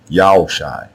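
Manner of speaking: "Yaoshai" repeated clearly as yah-oh-shy, falling at the end; correct name pronunciation. Shown as a demonstration. Teacher repeating how to pronounce Hoshai's name, correct form. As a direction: say "Yaoshai" with no H, Ya gliding into O. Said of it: "So now the way you pronounce Hoshai's name".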